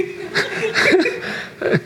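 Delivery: very high breathless giggle; airy giggle. Real, not acted. Preacher laughing while recounting his dance. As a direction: let a squeak of laughter out.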